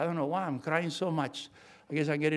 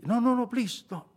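higher, pleading protest; acted pleading refusal; urgent retold refusal; 6 semitones higher than usual. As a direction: wave the offer away urgently. Acts out refusing the check.

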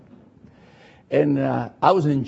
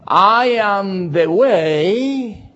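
very slow, solemn quote; scripture reading; 3 semitones higher, 3 dB louder, much slower than usual. Acted. Preacher quoting Jesus to the church, very slow. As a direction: draw the words out with reverence.

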